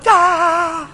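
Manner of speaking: sudden high yelp of Da, with a laugh; imitated tongues. Preacher imitating the girl's first tongues, high yelp. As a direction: mimic a child bursting into tongues.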